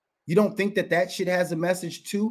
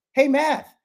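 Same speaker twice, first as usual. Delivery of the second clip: higher, louder taunting hello; mock hail; 11 semitones higher, 6 dB louder than usual. Host mock-greets Matthew Hoffa, loud taunting hail. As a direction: hail him like a buddy, mocking.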